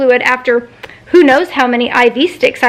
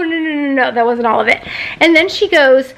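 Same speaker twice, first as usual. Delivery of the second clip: ordinary delivery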